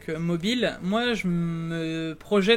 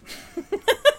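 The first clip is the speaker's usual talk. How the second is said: amused laugh; 14 semitones higher, 4 dB louder than usual. Real, not acted. Laughing at a tech hassle.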